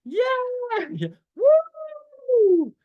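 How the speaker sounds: put-on squealing happy child, high and loud; excited kid. Shown as a demonstration. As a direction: cheer like a thrilled little kid. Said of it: "make a sound of a happy child".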